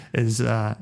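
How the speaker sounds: ordinary talk, nothing marked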